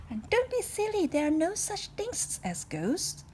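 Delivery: high, slow, emphatic dismissal; admiral's voice. Acted. Admiral dismisses ghosts, high and emphatic. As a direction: scold the notion away.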